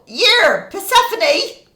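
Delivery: high, loud coarse holler; rough parent yelling. Acted. Parent yells for child in a supermarket, coarse shout. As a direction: holler it like the other end of the spectrum. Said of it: "the other end".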